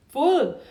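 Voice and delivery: full punched high and loud; emphatic full. Real, not acted. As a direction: hit full hard, then let it drop. Head overloaded after long talk.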